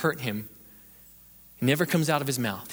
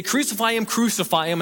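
raised, urgent imitation of a mob; mock crowd chant in a preacher's raised voice; retold crowd cry; 7 semitones higher, 3 dB louder than usual. Preacher voicing the crowd at the cross, urgent cry. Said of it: "when they say".

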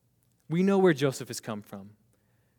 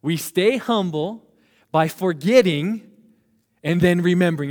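loud call-and-repeat; 3 semitones higher, 8 dB louder than usual. Shown as a demonstration. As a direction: call it out for the congregation to echo. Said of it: "But say that with me".

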